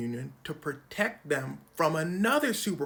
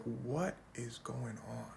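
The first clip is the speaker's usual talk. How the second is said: slow, softer whisper; mock Rubio whisper; 4 semitones lower, 9 dB quieter, slower than usual. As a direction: whisper it bewildered. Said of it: "sitting here like".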